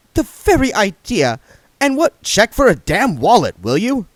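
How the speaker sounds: scandalised, voice up high; indignant young woman. A narrator's reading.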